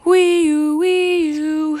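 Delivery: mock siren wail; siren noise. Player imitates a fire truck siren, playful. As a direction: sing out a siren.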